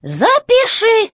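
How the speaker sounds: high, slow, commanding robot boss; robot chef voice. A narrator's reading. Robot chef demanding answers from the old robot, high and commanding. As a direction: bark orders from up high like a tin general.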